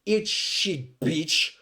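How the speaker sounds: high opening, re-enacted spite; retold pissed-off. Lab worker smearing grape back on patient, pissed off. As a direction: curse them in mock anger, without shouting. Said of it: "I was pissed".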